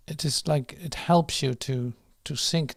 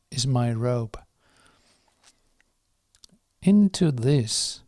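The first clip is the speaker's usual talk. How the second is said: slow, measured prayer with long pauses; slow prayer reading; 4 dB louder than usual. Real, not acted. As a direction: read each phrase firmly and deliberately, letting it settle. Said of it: "I will read it slowly".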